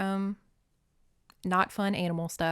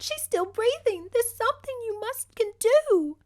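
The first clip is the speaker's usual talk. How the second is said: high, desperate pleading; a much higher girl's voice, pleading. Acted. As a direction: beg him to save her. Lizzie pleads for pony.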